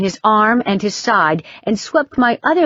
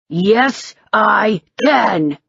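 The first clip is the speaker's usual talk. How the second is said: snarled; 2 semitones higher, much slower than usual. A narrator's reading. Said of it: "he snarled".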